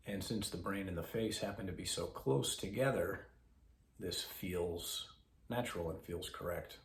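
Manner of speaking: soft, measured voice with long pauses; guided meditation. Acted. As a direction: guide listeners gently into breath awareness.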